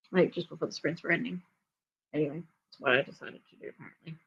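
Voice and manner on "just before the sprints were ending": usual speaking voice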